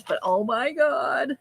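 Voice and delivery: slow, drawn-out gush; overwhelmed by cuteness. Real, not acted. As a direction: stretch it out, melting. Gushing over a puppy picture.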